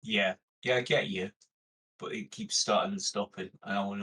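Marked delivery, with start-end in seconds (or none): none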